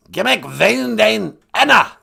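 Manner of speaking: loud, high learner repeating carefully; high voice hammering each word; high-pitched guest character. Acted. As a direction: belt the phrase back in a high character voice. Guest figure repeats after host.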